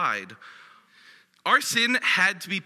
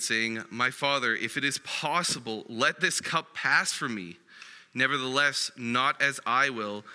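scripture reading; 4 semitones lower than usual. Acted. Reads Matthew aloud.

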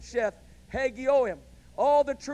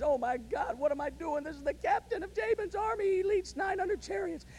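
high, hushed, scared mock whisper of a woman; lightly voiced frightened woman, pitched up; trembling; 8 semitones higher, 4 dB quieter than usual. Jael trembling over sleeping Sisera, high and hushed. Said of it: "she's trembling".

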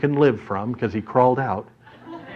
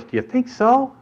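hopeful friend; 9 semitones higher than usual. Acted. Henry hopefully asks after flattery.

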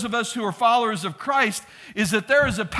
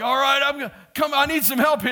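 higher-pitched plea to a spotter; calling for help; 6 semitones higher, 4 dB louder, faster than usual. Retold or imitated. Lifting partner asking his spotter for help, urgent call. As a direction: call out as if needing a hand. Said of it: "he'd be like".

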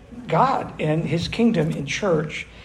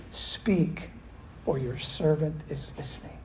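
reverent softness; 2 semitones lower, 9 dB quieter than usual. Retold or imitated. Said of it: "Eli says this".

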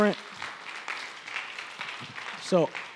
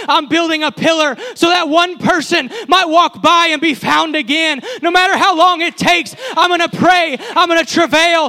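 rising, louder preaching build; a pastor urging the church toward lost family, louder and higher; 4 semitones higher, 4 dB louder than usual. Acted. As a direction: preach it home, climbing in force. Preaching climax again.

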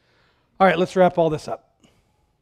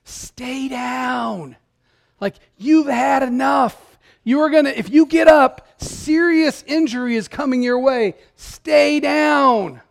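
trainer yelling; 6 semitones higher, slower than usual. Retold or imitated. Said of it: "his trainer's out there yelling at him".

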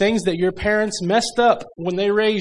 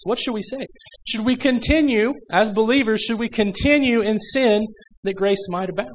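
higher, rising challenge to the church; rhetorical preaching; 5 semitones higher than usual. Acted. Preacher challenging the church with a raised question. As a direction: throw the question out to the congregation.

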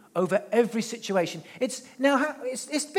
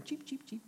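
birdsong imitation; 6 semitones higher, 13 dB quieter than usual.

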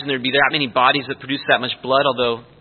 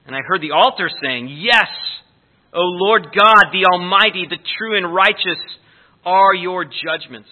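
scripture reading; 6 dB louder than usual. Acted. Reads scripture aloud.

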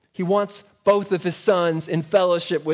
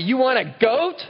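incredulous; 6 semitones higher than usual. Real, not acted. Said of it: "one of the most ridiculous statements".

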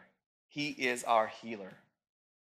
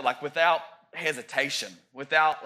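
normal talking voice

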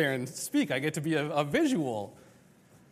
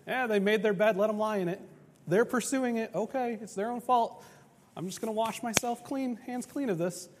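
mock God washing his hands, higher and shrugging; a light, higher shrug-off voice; dismissive shrug; 6 semitones higher than usual. Preacher imitating an indifferent God, mock shrug.

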